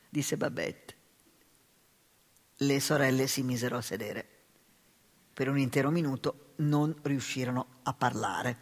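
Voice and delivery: usual speaking voice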